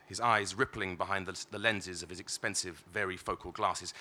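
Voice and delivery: ordinary delivery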